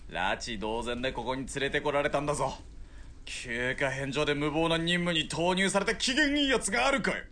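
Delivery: angry outburst. Acted. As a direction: vent the anger, building to the end. Soldier complains about lost leave. (怒りを爆発させて声を荒げる)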